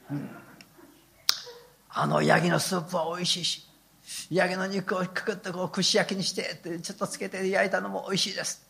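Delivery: soft, hushed mock prayer of a hungry man; a lightly voiced greedy little prayer; Peter's hungry prayer. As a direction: pray sweetly for goat meat, half joking. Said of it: "祈ってて".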